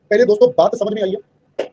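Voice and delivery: ordinary talk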